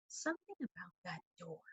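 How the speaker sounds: very soft, hushed thinking; inner thought. A narrator's reading. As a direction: murmur it to yourself.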